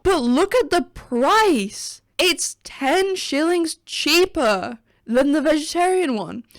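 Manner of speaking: high, loud disbelief at the price; can you believe this; indignant rant over a menu price; outraged disbelief. Real, not acted. Outraged at cheaper meat burger.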